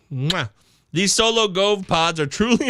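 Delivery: ordinary talk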